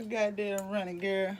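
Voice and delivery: ordinary delivery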